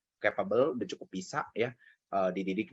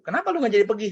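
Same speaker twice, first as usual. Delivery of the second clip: high, louder mock voice of mother; mother's question; 11 semitones higher, 6 dB louder, faster than usual. Mother at the dinner table questioning her son, high mock voice. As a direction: play the mom asking sharply. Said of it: "kata dia".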